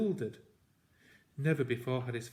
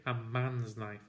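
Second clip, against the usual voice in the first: ordinary delivery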